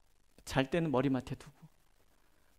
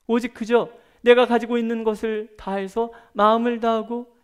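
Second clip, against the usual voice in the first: louder, higher preaching build; forceful preaching; 6 semitones higher, 8 dB louder than usual. Acted. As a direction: drive the point home to the congregation. (힘 있게 외치는 설교)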